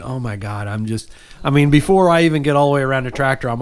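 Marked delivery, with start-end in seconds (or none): 0.0-1.1 low, soft retold dismay; quiet aside of disbelief; muttering to oneself. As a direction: drop it low, as if to yourself. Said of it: "I'm looking at it by myself".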